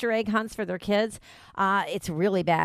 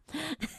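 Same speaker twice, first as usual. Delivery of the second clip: short laugh; 9 semitones higher, 12 dB quieter than usual. Real, not acted. Laughs after the sign-off.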